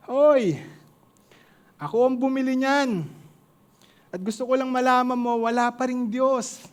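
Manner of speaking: high shouted gloat that fades toward the end; mocking shout. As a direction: yell it over at her with a smirk. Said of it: "Yun pa rin ang sinigaw".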